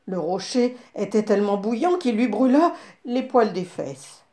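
plain reading voice

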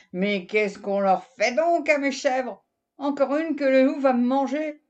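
exasperated outburst. Acted. Farmer despairs over his goats, loud and exasperated. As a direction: throw up your hands at the goats.

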